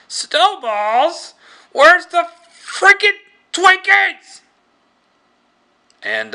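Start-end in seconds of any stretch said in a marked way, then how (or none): 0.1-4.4 much higher, louder, slow furious shout; outraged yell. Retold or imitated. Tallahassee finding no Twinkies in the truck, furious slow yell. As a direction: roar it out in disbelief, dragging each word.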